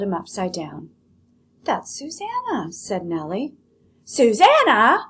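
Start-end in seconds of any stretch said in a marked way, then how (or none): 1.6-2.7 put-on high, mild old woman; lightly voiced old woman; Nellie's voice. Teacher reading Nellie's answer to her daughter, high and mild. 4.0-5.0 loud, high shout of the name. Acted. Lolly shouting at her mother over the rabbit, loud and high. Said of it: "shouted Lolly".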